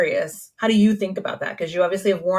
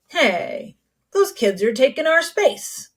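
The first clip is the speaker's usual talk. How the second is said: higher, slowing into a mock complaint; mock annoyance; 7 semitones higher, slower than usual. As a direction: grumble like a put-out old-timer, lightly. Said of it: "it's almost like we're annoyed".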